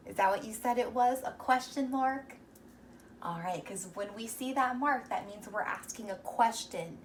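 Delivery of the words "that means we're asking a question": ordinary talk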